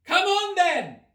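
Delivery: high imperious roar; queen roaring. A narrator's reading. Queen calls Alice along, high roar. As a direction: summon everyone. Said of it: "roared the queen".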